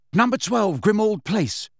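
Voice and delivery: calling the address high and firm; cried out. Acted. Harry calling out his destination into the fire, cried out. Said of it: "and cried".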